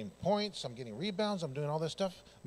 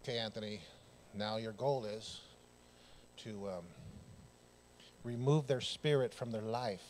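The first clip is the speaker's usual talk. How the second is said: quiet self-talk; 6 semitones lower, 7 dB quieter than usual. Retold or imitated. Player quietly psyching himself up before a comeback, hushed menace. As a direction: coach yourself in a low sinister hush. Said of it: "I would just say".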